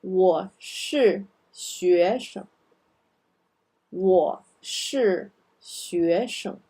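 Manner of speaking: 我是学生 slow, 生 light tone, northern Chinese accent; slow northern Chinese reading of 我是学生. Shown as a demonstration. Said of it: "I'm using the northern accent here".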